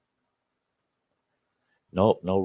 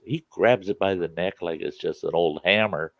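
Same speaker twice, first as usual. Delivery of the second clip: ordinary talk, nothing marked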